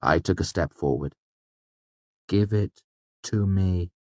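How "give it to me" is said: slow, level, emotionless demand; cold flat command. A narrator's reading. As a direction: drain all feeling out, word by word. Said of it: "emotionless and flat".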